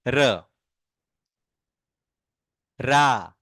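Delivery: each syllable drawn out alone, long pauses; slow syllable drill. Shown as a demonstration. Teacher showing the ra barakhadi, slow and clear. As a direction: chant each ra syllable slowly for the class. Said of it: "र की बारह खड़ी बनाएंगे".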